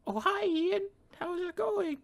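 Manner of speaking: high sweet mom voice; falsetto mom. Acted. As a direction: play a sugary mother high up.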